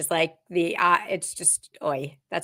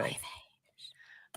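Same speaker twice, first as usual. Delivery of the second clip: breathy whispered oy vey; a hushed oy vey; 12 dB quieter than usual. Pretend.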